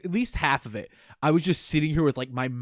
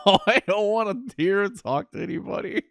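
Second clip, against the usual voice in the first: higher-pitched laughing groan of dread; exaggerated groan; 5 semitones higher than usual. Player dreading this character's next conversation, hammed-up groan. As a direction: groan the whole complaint, half laughing. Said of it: "I groan".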